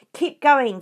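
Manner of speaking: loud, high bellow to the crew; shouting. A narrator's reading. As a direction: yell orders over the engines. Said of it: "the fat Bogus shouted".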